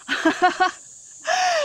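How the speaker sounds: high shrieking laugh; loud burst of laughter. Real, not acted. Teasing husband, big laugh.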